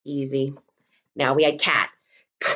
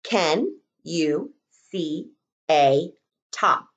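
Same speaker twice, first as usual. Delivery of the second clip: slow dictation; 5 semitones lower, much slower than usual. Shown as a demonstration. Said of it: "The next sentence is going to say".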